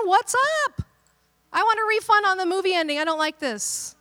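high, loud indignant whine, hammed-up; mock outrage. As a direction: play the cheated moviegoer demanding a refund. Mock complaint to Jesus.

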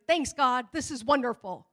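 loud, exasperated mock gratitude; sarcastic thanks. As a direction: thank God as if it's the last straw. Driver sarcastic after stranger breaks down.

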